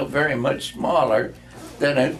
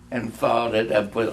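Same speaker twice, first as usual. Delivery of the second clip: ordinary delivery, nothing marked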